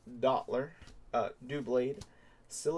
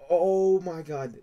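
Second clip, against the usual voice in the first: excited shout; 5 semitones higher, 7 dB louder, slower than usual. Real, not acted. Still reeling from the pull.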